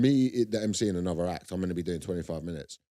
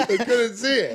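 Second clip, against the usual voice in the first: high, louder through laughter; laughing; 5 dB louder, slower than usual. Real, not acted. Co-host laughing about a cozy blanket, laughing speech. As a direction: talk while still laughing.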